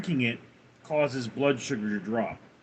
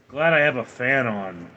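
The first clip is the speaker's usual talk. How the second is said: normal talking voice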